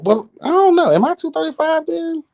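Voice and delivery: high startled wonder; surprised. Real, not acted. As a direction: catch yourself in surprise. Surprised self-question.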